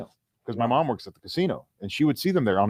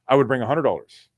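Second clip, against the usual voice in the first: usual speaking voice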